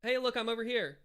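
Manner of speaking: soft, high-pitched call across the room; calling without yelling. Shown as a demonstration. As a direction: catch someone's attention across a room, kept quiet. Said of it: "without being super loud".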